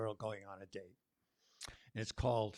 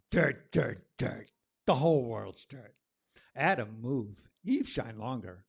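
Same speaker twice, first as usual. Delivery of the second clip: spoken word poem; 3 semitones higher, 8 dB louder, slower than usual. Acted. Poet reading a spring poem to the open mic crowd, declaiming loudly. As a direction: perform the poem like calling the earth awake.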